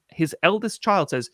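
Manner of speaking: ordinary talk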